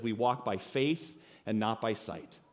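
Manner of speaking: slow, soft and earnest, weighing each word; solemn scripture reading. Shown as a demonstration. Preacher reads the verse slowly and softly to the congregation. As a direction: read the verse as a quiet heartfelt truth. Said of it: "that's really easy to say".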